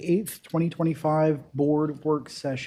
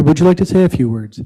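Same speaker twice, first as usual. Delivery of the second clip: louder repeat; 9 dB louder than usual. Real, not acted. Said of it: "Would you, I'm sorry".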